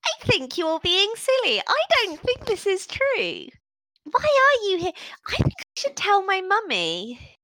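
put-on high, pouting little girl with arms crossed; a child threatening to tell her mum; stubborn orc child. Orc child crossing her arms at the adventurers, high and pouting. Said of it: "see her cross her arms".

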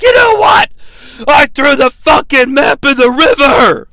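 very high, loud hammed-up yell; shouted outburst, imitating a meltdown; mock furious shout. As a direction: blow up in a parody tantrum. Acts Cole's outburst in the meltdown.

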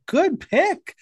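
high approving voice; approving quote. Retold or imitated. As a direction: say it like a pleased fan. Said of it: "So I was like".

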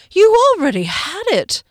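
high, loud exasperated cry; angry outburst. A narrator's reading. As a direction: throw it at him in disbelief. Kier explodes at Golgothar.

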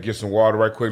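ordinary talk, nothing marked

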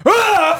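high, loud cry of fake shock; mock horrified yell. Man acting out seeing a toothless woman, mock horrified yell. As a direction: recoil out loud in mock horror.

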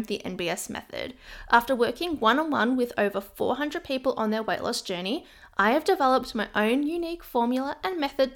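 bright promo voice for her coaching program; ad read. Acted. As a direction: sell the program with energy.

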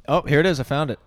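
loud excited discovery. Real, not acted. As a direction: burst out as you find it. Finds the old video.

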